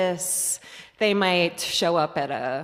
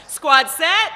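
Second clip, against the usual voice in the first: louder, higher leader call; powerful squad call; 7 semitones higher, 7 dB louder than usual. Shown as a demonstration. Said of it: "A little more powerfully".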